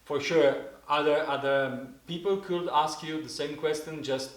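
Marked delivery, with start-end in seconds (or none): none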